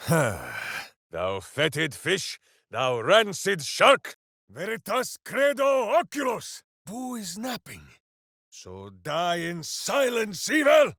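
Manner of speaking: rising berserker war cry, ending in a roar on evil; battle cry. Acted. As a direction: roar insults at the foe, build to the shout.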